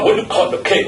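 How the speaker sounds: "cut" said with a rounded "aw" vowel; cut as "cot". Shown as a demonstration. Teasing listeners about English accents, "cut" pronounced "cot". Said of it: "Oh, don't say".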